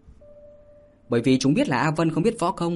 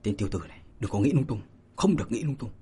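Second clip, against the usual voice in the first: softer, muttered scolding to himself; self-scolding; 3 semitones lower, 5 dB quieter, faster than usual. A narrator's reading. He rebukes his own wandering thoughts, quiet mutter. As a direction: scold yourself under your breath. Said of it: "gã bất giác tự mắng".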